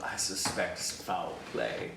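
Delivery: slow, soft, low Southern drawl, lightly imitated; Southern accent. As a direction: savour the line in a hint of a Southern accent. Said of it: "that quintessential line right there of".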